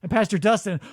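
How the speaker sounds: normal talking voice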